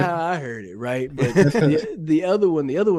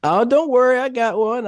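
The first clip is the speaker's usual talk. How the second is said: much higher and louder, eager reassurance; excited; 11 semitones higher, 6 dB louder than usual. Real, not acted. Answering the host's wide receiver demand, excited. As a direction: burst in eagerly.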